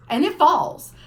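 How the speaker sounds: ordinary talk, nothing marked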